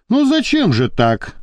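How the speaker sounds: understated reproach; reproachful. A narrator's reading. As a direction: gently chide him. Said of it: "укоризненно покачал головой".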